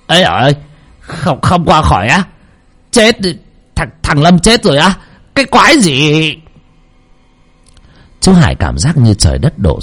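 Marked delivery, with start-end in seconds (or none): 0.1-6.3 rising, higher voice in stunned disbelief; can't believe what he hears; shocked disbelief. A narrator's reading. Uncle hearing of the boy's death, stunned disbelief.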